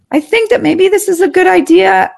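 high, timid and hedging; meek. Shown as a demonstration. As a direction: shrink back, unsure of yourself. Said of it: "that's way too meek".

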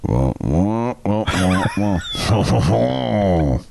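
lightly voiced muted-trumpet wah-wah; a hint of French in the wah-wah teacher; Charlie Brown teacher. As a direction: mimic the cartoon teacher's muffled horn voice. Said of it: "Charlie Brown teacher, let's say, with a French accent".

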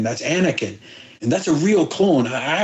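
ordinary talk, nothing marked

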